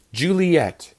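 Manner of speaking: high, falling call; parent yelling full name. Shown as a demonstration. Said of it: "when your parents are yelling at you".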